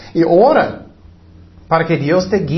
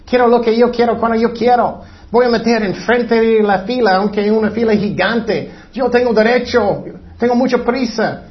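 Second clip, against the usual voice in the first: high mock tantrum; mimicking a whining, demanding child; angry childish adult; 5 semitones higher than usual. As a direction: throw a put-on child's tantrum. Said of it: "Están enojados".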